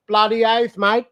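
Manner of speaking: broad Aussie bloody oath; aussie tradesman. Shown as a demonstration. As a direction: ocker Aussie, loud and proud. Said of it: "Say it".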